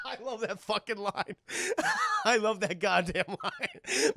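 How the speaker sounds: words broken up by real laughter; laughing. Real, not acted. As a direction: talk while cracking up. Cracks up at own impression.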